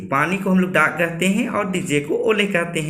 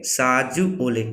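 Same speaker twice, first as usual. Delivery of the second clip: साजू ओले said slowly, each word set apart; slow, with pauses; 4 semitones lower, much slower than usual. Shown as a demonstration. Teacher modelling the Kharia phrase for students, slowly. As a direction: ease साजू ओले out gently for repeating. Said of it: "मेरे साथ बोलिए".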